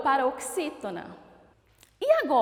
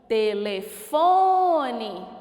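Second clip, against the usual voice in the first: "Telefone" slowly, with a long "fo"; calling out the word; 3 semitones lower, 4 dB louder, much slower than usual. Shown as a demonstration. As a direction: call "Telefone", holding the third syllable. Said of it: "Chama a palavrinha telefone".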